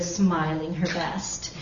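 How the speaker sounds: ordinary delivery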